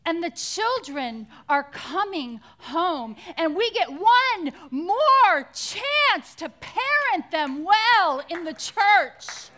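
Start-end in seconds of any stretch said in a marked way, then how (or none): 3.4-9.5 loud, stretched-out preaching at full force; impassioned preaching. Real, not acted. Preacher urging the church to parent returning children, loud and impassioned. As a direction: drive each word out slowly and forcefully.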